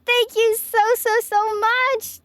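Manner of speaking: high, delighted thanks, drawn out slowly; excited squeal. Real, not acted. Streamer thanking Tulip for her support, squealing with delight. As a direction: gush your thanks to a friend, pitch way up.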